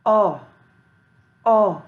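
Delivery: ओ as an isolated vowel sound; phonics O sound. Shown as a demonstration. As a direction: sound out ओ for letter O. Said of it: "ओ का sound क्या होता है". (ओ, अक्षर O की ध्वनि)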